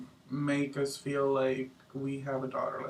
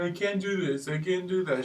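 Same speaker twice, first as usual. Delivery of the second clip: whiny mocking voice; 4 semitones higher than usual. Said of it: "She sounds like a whiny little baby".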